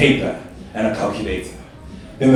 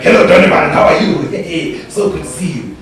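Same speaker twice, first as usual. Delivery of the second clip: hammed-up delighted greeting; gushing lottery-winner greeting; 4 semitones higher, 4 dB louder than usual. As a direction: imitate an overjoyed, loud welcome. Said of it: "he'd greet them like they just won in the lotto".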